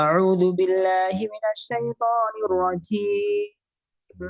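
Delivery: slow melodic tajweed chant; Quran recitation. Shown as a demonstration. Teacher reciting for students in slow tajweed chant. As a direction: recite slowly, drawing out each vowel.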